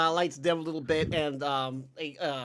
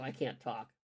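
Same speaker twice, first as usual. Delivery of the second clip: ordinary talk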